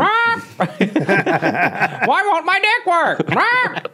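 parrot squawk. Pretend. Caged parrot on the porch squawking crude lines, high parrot squawk.

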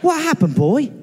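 high mock-British Lassie owner; talking to a dog. Owner asking the British dog what's wrong. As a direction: coo it at a clever dog. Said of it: "I think he's trying to tell us something".

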